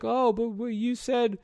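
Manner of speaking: mock hurt kid brother protest; upset little brother. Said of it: "he's all upset".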